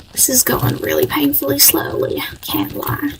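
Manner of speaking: unvoiced, soft complaint; whispered aside. Real, not acted. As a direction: whisper it like a secret. Whispered aside while game loads.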